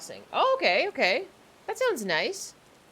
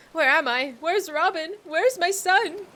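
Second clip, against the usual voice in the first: frantic mother; 10 semitones higher, 5 dB louder, slower than usual. Acted. Voicing a shipwrecked mother NPC.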